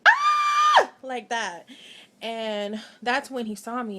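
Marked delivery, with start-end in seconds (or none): 0.1-0.9 very high, loud scream of pain. Retold or imitated. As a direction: let out a sharp shriek as the nail goes in. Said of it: "So I screamed".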